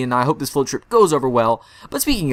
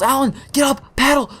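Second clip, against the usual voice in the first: high-pitched mock yell; half-strength yell, pitched way up; retold yell; 10 semitones higher than usual. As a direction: imitate a friend yelling urgent orders. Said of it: "and he yells".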